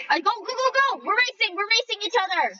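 high, fast excited cheering; excited squeal. Real, not acted. Gamer racing another player, high excited cheering. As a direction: shriek it out as you race.

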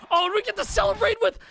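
high, squealing fan voice; mock excited. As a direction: gush like a giddy fan. Celebrating Bon Jovi coming on.

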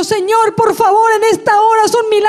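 high, pleading prayer; oración suplicante. Retold or imitated. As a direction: beg God from the stretcher. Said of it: "voy en la camilla y digo". (súplica urgente a Dios, con voz aguda)

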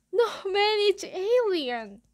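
high, drawn-out jeer; jeering excitement. A narrator's reading. Kid outdoing his friend's insult, excited jeer. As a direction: top the last joke.

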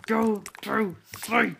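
slow, drawn-out go to sleep; slow stern command. Real, not acted. Player slowly ordering the sim baby to sleep. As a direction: spell it out slowly.

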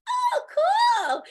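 put-on a toddler thrilled by the mess; delighted kid. Son pulling out the Rice Krispies container, gleeful squeal.

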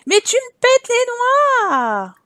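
high, loud, slow furious outburst; rage. Real, not acted. Streamer loses it at her useless Sim, high and slow. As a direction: let the rage burst out, drawn out. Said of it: "c'est la rage".

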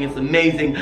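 normal talking voice

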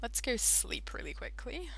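hurried whisper; unvoiced hurried whisper. Real, not acted. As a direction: whisper it quickly to yourself. Whispered rushing to bed.

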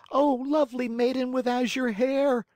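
high, loud, desperate cry; cried out. Acted. Pinocchio cries up to the maiden's window, loud desperate plea. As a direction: call up to the window in despair. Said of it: "cried Pinocchio".

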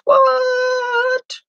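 high voice. Real, not acted. Reacting to a thirty-five dollar puzzle price, high squeal. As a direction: squeak it out in disbelief. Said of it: "that make me use my high voice".

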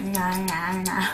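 chewing while talking, fast; chewing gum. Real, not acted. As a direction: gum in the mouth, quick aside. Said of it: "Sorry, I've got chewing gum in".

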